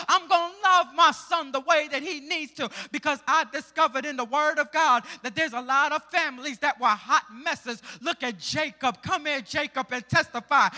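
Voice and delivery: loud preacher's call; fervent sermon delivery; preaching. Acted. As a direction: summon the witness. Preaching continues.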